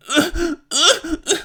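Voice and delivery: helpless high-pitched laughter; high cackling laugh. Real, not acted. Recalling the grunting runner, shrieking laughter. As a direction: crack up completely.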